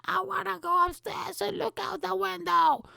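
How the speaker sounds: put-on very high falsetto kid voice; hammed-up little-kid demand; whining child. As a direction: pester mum in a piercing mock child squeal. Acting a whining child to mother.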